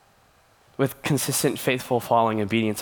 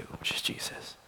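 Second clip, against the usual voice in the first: breathy whisper, almost no voice; hushed whisper of a key point; whispered aside; 13 dB quieter than usual. Real, not acted. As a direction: drop to a hush on the name.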